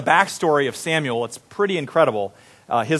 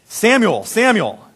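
raised, higher call of a name; 6 semitones higher, 3 dB louder, slower than usual. A narrator's reading. The Lord calling to the little boy Samuel, calling out. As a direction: call out to someone.

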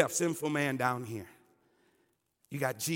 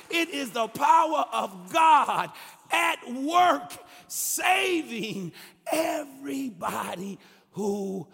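building from firm declaration to a raised cry; impassioned scripture preaching; 8 semitones higher, 4 dB louder, slower than usual. Acted. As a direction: proclaim it with growing force, stretching key words.

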